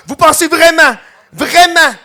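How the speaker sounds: high, loud indignant mock shout; mock yell. Imitating the woman protesting to the police, high loud mock shout. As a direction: hammer the two vraiment in outrage. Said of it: "elle gueulait tellement fort".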